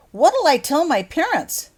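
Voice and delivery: loud, high and slow alarm; panicked outburst. Acted. Dudley frets to Harry about his parents, loud slow panic. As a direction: plead in sudden panic.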